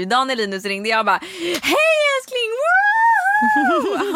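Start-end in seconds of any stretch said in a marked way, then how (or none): 1.6-4.1 high, loud whooping hello; overjoyed greeting. Retold or imitated. Woman re-enacting her phone greeting to partner, wildly happy. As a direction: squeal it out, hammed-up delight. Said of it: "så jävla glad och positiv".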